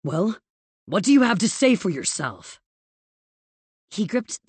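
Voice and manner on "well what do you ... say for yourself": demanding, raised voice on the question; stern demand. A narrator's reading. Villain confronting Evie over the letter, stern demand. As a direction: press hard for an answer, getting louder. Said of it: "as he demanded again".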